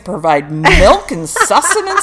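high, loud comic boasting; proud exclamation. Retold or imitated. New mother praising her changed body, playfully proud.

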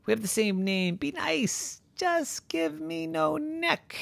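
soft, very high begging; pleading. Acted. Llama begging to lose its neck, pleading softly. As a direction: wheedle in a tiny high voice.

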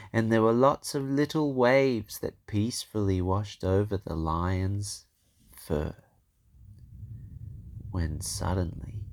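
usual speaking voice

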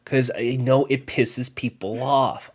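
slow, low drawn-out deadpan; deliberate joke delivery. Real, not acted. Podcaster explaining his trolling to co-host, slow deadpan. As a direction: stretch it out slowly, low and measured. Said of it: "is that how you deliver your jokes?".

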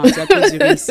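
quoting the driver high and loud, laughing through it; mocking laugh. Taxi driver teasing a newcomer, laughing mockery. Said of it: "lui-même, il va dire".

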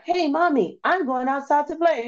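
put-on high-pitched little-girl dub voice; mock little girl. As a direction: imitate a little girl in a dubbed film. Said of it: "Little girl be like".

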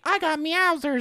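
mock cute anime girl voice; high girly voice. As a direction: squeak it like a ditzy cartoon girl. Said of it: "she's like".

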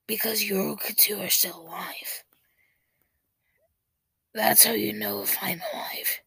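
raspy whispered character voice; hoarse scratchy whisper. Acted. With scritchy scratchies.

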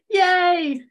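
high, loud little cheer; happy cheer. Real, not acted. Host cheers to her guest after fixing the Wi-Fi, a high happy yay. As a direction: cheer because it finally worked. Connection restored.

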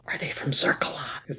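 hushed conspiratorial whisper; secretive whisper to a friend. Real, not acted. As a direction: lean in and whisper the question. Sudden conspiratorial question to cohost.

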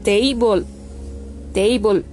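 "table" pronounced TEI-bol. Shown as a demonstration. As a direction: show the E-I sound in "table". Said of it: "E I, ¿vieron?". ("table" con la A pronunciada E I)

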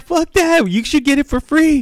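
high, loud mock outrage; retold indignant outburst. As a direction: insist indignantly on the victim's behalf. Teller's indignant reply, acted.

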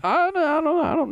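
defending himself against a gross accusation, squeaking; squeaky denial. Real, not acted. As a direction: deny it in a shocked squeak. Protesting denial.

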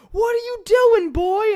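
very high, loud mock yell; a hammed-up angry driver stepping out. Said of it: "It's fucking [name]".

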